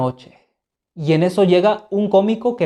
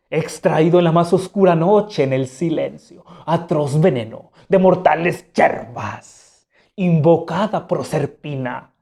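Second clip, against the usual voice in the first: slow, solemn poisoner's speech; stage villain; slower than usual. Acted. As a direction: declaim it slowly like a stage murderer. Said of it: "el cómico matador dice". (actor villano, lento y solemne)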